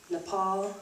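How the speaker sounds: normal talking voice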